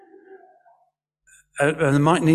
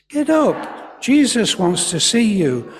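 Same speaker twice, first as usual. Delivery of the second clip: high-pitched urging call; raised voice, starting high; 6 semitones higher than usual. Acted. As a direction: urge someone up from across a crowd. Leading ladies as calling crowd.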